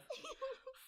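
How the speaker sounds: high, tiny elf giggle; a little creature giggling in the bushes. Acted. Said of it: "happy little voice giggling".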